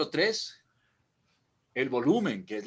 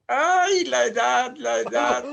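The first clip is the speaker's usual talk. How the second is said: high, loud mock wail; comic lament; 12 semitones higher, 11 dB louder, slower than usual. Real, not acted. Presenter laughing off a muddle, comic lament. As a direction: lament it loudly, drawn out and high. Jokes about getting old.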